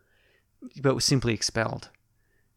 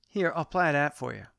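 usual speaking voice

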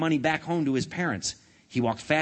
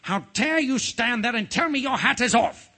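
high, indignant outburst; angry royal shout; 11 semitones higher, 3 dB louder than usual. A narrator's reading. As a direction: scold him as an outraged king. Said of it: "shaking an angry finger".

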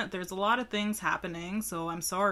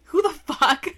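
high, loud disbelief; surprised outburst; 8 semitones higher, 8 dB louder than usual. Real, not acted. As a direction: blurt it out in shock. Surprised at names on can.

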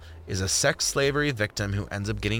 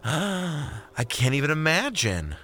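mock shock; 6 semitones higher, slower than usual. Mock gasp at extra weight.

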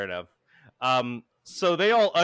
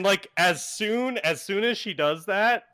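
ordinary talk

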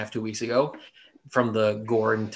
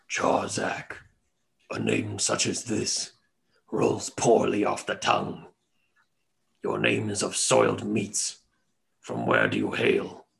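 haughty lion king; 4 semitones lower than usual. Acted. Lion king insults Jarzak.

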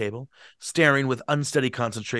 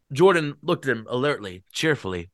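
ordinary delivery

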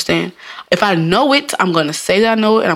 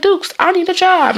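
job seeker's plea; 11 semitones higher than usual. Retold or imitated. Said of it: "They be like".

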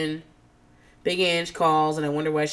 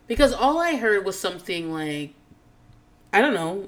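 ordinary talk, nothing marked

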